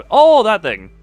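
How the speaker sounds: ordinary talk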